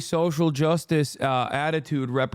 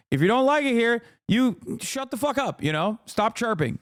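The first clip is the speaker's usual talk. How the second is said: higher, heated "shut the fuck up" at critics; angry rant; 8 semitones higher than usual. Real, not acted. Ranting at viewers who dislike his tone, angry and high. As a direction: let the anger spike up in pitch.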